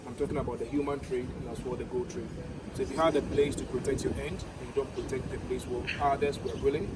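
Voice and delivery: usual speaking voice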